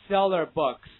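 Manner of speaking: "seller books" loud and run together as a model; linked reading. Shown as a demonstration. Teacher drilling h linking for students, loud linked reading. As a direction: say "seller books" loudly as one joined unit. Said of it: "大家一起跟我读一下啊".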